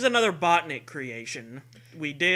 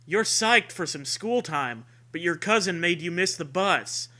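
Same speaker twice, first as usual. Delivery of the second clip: usual speaking voice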